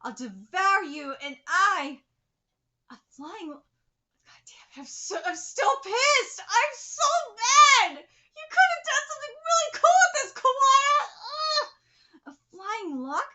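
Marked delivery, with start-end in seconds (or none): 2.9-4.8 slow, breathy hushed curse; muttering at the page; frustrated whisper. Real, not acted. 4.8-11.7 high, loud, rushing outcry; angry rant. Real, not acted. Ranting at the author.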